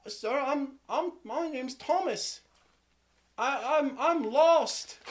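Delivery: put-on high-pitched, stammering child; small, high, halting kid voice; scared lost boy. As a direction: stammer it out like a timid little boy. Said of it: "He says".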